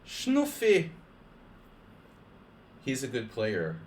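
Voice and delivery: high-pitched imitation of a Serbian wife's accent. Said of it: "she has this really strong Serbian accent".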